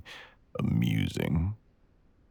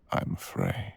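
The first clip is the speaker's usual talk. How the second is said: usual speaking voice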